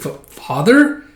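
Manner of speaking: high, slow questioning voice; hesitant daughter. Acted. Daughter sees her father visiting, hesitant surprise.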